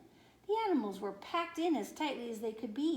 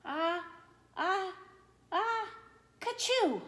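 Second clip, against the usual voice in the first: enormous sneeze; 9 semitones higher, slower than usual. A narrator's reading. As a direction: wind up a huge bear sneeze and let it explode. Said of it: "gave an enormous sneeze".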